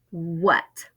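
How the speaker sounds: usual speaking voice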